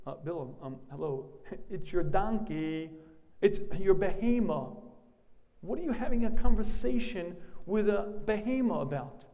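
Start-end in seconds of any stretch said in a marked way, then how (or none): 1.7-9.2 incredulous. Acted. Mocking Bilam's absurdity.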